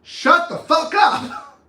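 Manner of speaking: high, slow, loud yell; explosive order to be silent; shouted command. Shown as a demonstration. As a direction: yell it, every word drawn out. Blunt tip, yelled.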